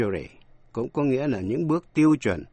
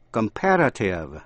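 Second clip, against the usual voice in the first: Comparative drawn out slowly, accent on second syllable; stress on "pair"; 3 semitones lower than usual. Shown as a demonstration. Teacher shows learners Comparative stressed on the "pair" syllable. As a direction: say Comparative as com-PAR-a-tive. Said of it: "nhấn vào vần pair".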